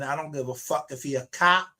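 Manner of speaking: ordinary talk, nothing marked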